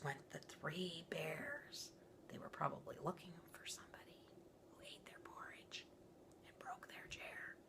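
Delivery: hushed, suspenseful whisper. A narrator's reading. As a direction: breathe it like a secret.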